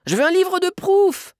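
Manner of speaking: put-on very high, loud child's wail; shrieked, high child protest; protesting little girl. A narrator's reading. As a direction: squeal the demand like an insistent kid. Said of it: "Mais Sophia protesta".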